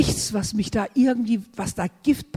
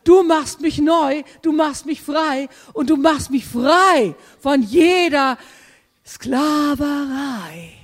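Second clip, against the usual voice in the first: put-on higher, bright little-child voice; higher-pitched childlike confession; childlike prayer; 5 semitones higher, 3 dB louder than usual. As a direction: speak as a trusting small child. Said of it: "dann nehme ich das einfach an und sage".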